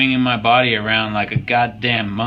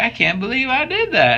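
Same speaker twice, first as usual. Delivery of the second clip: higher-pitched imitation of her disbelief; imitating amazed partner; 11 semitones higher than usual. Trainer retelling his partner's reaction after her set, mock amazement. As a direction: lift the pitch, mimicking an amazed lifting partner. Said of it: "She's like".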